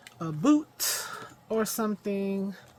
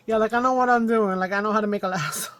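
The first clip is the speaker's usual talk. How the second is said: louder, laughing sarcastic brag; laughing self-mockery; 3 semitones higher, 11 dB louder, faster than usual. Real, not acted. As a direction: laugh at her own claim, half-shouting. Self-mocking about making a lasso.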